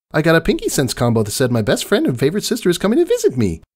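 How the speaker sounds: high, fast, breathless gushing; rapid excited chatter. A narrator's reading. Pinkie tells Maud her Pinkie Sense predicted her, high and racing. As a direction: rattle it off at top speed, bouncing.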